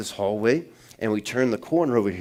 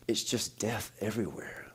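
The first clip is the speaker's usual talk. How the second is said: much softer, hushed and grave; hushed eerie quote; 11 dB quieter than usual. Retold or imitated. Pastor voicing late wife in dream, hushed and eerie. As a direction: let it fall to a near hush. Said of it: "she goes".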